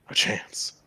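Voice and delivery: a sudden hushed whisper. Acted. Player whispering a sly idea to the table. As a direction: breathe it out with no voice. Gasp then hushed aside.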